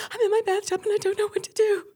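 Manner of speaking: very high, frightened mock voice; panicked caller. Caller hiding in bathtub to radio host, panicked. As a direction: imitate a terrified caller in a high squeal.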